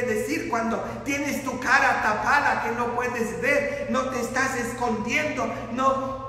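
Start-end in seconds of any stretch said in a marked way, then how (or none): none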